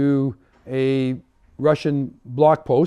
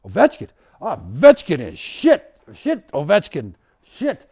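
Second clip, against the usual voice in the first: a mock Russian accent, louder and higher, scornful of Ovechkin; 4 semitones higher, 5 dB louder than usual. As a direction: Ovechkin spat out with a put-on Russian accent, dismissive. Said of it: "his response was".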